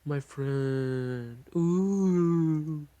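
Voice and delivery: high, loud eerie ghost voice; ghostly mentor. Acted. Spirit greets the player in a vision, eerie moan. Ghost figure appears in hallucination.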